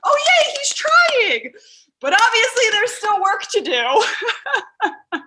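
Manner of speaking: high, loud, slow laughing cheer; laughing mock cheer. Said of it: "I was like".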